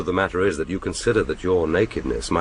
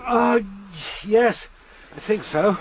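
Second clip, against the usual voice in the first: high, tight, breathless answer through lingering pain; strained relief; 10 semitones higher, slower than usual. Acted. Telling Kissy the cramps are going, strained and pained. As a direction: answer while still hurting. Cramp easing under treatment.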